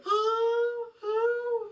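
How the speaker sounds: very high, drawn-out fake moans; mock moaning. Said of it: "start moaning more and more".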